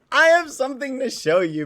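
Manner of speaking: high squeaky cute voice; cute anime streamer. Acted. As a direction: sing it out high and sweet. Continues cute voice.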